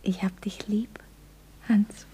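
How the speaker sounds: hushed, intimate, pausing before the name; tender whisper. Acted. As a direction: whisper it to your sweetheart. Whispered declaration of love.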